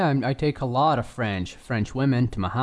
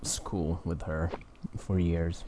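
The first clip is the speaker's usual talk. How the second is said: usual speaking voice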